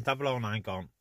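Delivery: ordinary talk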